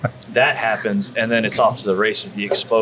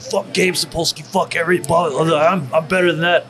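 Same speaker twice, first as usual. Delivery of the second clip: mock arrogant rant; 6 semitones higher than usual. Mocks an arrogant attitude he rejects.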